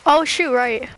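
normal talking voice

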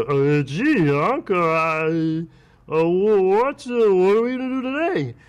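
high, loud, halting dopey voice; Wally impression. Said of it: "used to talk like this".

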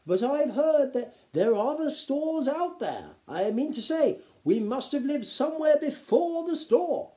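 timid minor nobleman; hesitant lord. A narrator's reading. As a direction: tread carefully before objecting. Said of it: "said one of the lesser lords hesitantly".

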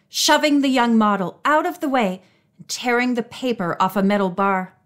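usual speaking voice

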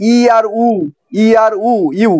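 ই and উ held apart, then blended as ইউ; sounding out syllables. Shown as a demonstration. Teacher proving ইউ has two sounds, sounded out. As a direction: draw out ই, then উ, then say ইউ. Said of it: "ইউ কিন্তু দুই অক্ষরের উচ্চারণ".

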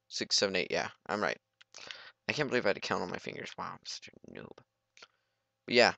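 ordinary talk, nothing marked

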